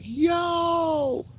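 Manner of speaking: high, excited call of greeting; a delighted shout across the store; elated yo. Retold or imitated. Greeting friend Alex as he walks in, elated. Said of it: "I was so elated".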